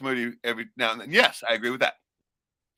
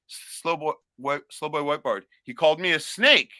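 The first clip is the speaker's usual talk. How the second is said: ordinary talk, nothing marked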